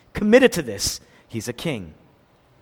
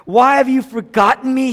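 loud, high anguished cry; 6 semitones higher, 8 dB louder than usual. Retold or imitated. Preacher voicing Jesus crying out on the cross, loud and high. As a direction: cry out in abandonment. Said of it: "he cries out on the cross".

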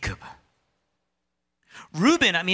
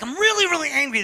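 higher and louder, fast mock outburst; mock angry shout; 11 semitones higher, 6 dB louder, faster than usual. Imitating an angry outburst nobody in church makes, loud and high. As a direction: blurt out the anger openly. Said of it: "No one ever says, like".

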